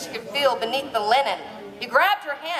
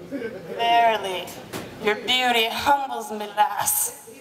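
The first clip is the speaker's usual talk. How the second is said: low, slow mock Scottish brogue, reverent; a romance hero adoring his lass; deep Scottish burr, unhurried and courtly; hammed-up Scottish lover; 3 semitones lower, slower than usual. Highland lover voiced by reader.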